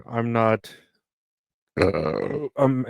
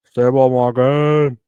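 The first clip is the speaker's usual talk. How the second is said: slow, louder hyped repeat; 8 dB louder, slower than usual. Shown as a demonstration. Talking to the camera, pumping himself up, slow and loud. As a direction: hype yourself up, slow and loud.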